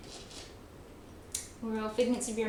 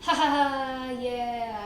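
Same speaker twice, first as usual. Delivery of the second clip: fake polite laugh; 3 semitones higher, 3 dB louder, much slower than usual. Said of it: "like, go".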